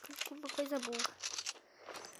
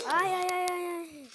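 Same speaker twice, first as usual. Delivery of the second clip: alarmed ai ai ai; panicked cries; 3 semitones higher, 4 dB louder than usual. Real, not acted. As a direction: cry out in a panic. Taking a hit in game.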